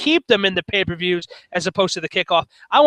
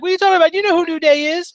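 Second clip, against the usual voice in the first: high incredulous question; high-pitched mock disbelief; 10 semitones higher, much faster than usual. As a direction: act stunned he doesn't know. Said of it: "And I'm like".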